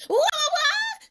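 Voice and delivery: high-pitched excited cry; startled shout. A narrator's reading. Emu seeing Robo Nene zoom off, startled shout. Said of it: "shouts Emu".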